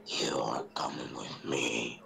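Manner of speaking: slow, creepy whisper; very soft. Acted. Evil doll at the bedroom door, slow menacing whisper. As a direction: whisper it low and drawn out. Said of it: "someone say this very softly".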